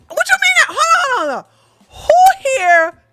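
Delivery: high, loud disbelieving yelp; incredulous outburst. Real, not acted. Streamer reacting to chat, high incredulous shout. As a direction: shriek in disbelief, stopping everyone. Outraged at chat's comment.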